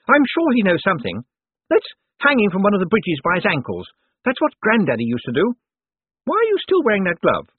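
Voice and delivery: high-pitched, pushy woman's voice; bossy sister. A narrator's reading. Sister urging her brother on about the hostage, bossy. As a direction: play a demanding sister, pitched well up.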